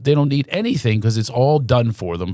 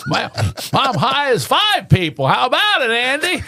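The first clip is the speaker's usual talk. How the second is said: Barney Fife; 12 semitones higher than usual. Retold or imitated. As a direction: lay on a squeaky, stretched-out Barney Fife, baked. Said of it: "You know, Barney Fife".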